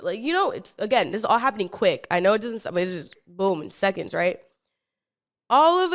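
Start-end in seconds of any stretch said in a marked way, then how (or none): none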